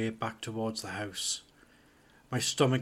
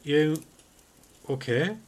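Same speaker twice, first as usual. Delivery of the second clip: questioning; 5 semitones higher, 5 dB louder than usual. A narrator's reading. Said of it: "Alan asked".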